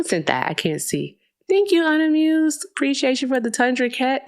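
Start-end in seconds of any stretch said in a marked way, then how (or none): 1.5-4.3 high, bright gushing thank-you; excited thanks. Real, not acted. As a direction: gush your thanks to the gifter.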